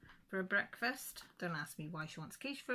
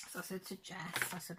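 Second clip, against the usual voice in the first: ordinary talk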